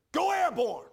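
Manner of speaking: full-throated, high rallying shout; retold yelling. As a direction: imitate a brother bellowing down the phone. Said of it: "He's yelling at, in the phone".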